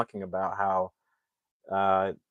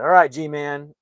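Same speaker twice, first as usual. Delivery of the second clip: loud, higher mock-chummy cheer; forced upbeat; 5 semitones higher, 9 dB louder, slower than usual. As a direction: imitate a dad trying out a buddy nickname. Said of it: "upbeat about it".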